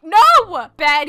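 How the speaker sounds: sudden high, loud no; scolding shout. Acted. Player stops pet human leaving, scolding shout. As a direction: cut them off with a sharp shout.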